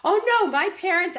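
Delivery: high, mock whiny protest; whiny client. Client pushing back by blaming parents, high and whiny. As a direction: pitch it up like a whining client.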